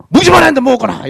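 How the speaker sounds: put-on high, loud scolding shout at a child; parent scolding yell. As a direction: bark it like a strict Korean parent at the dinner table. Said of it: "이러고 난리 날 겐데". (밥상에서 아이를 야단치는 높고 큰 고함)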